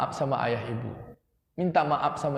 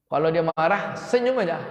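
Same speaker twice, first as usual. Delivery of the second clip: ordinary delivery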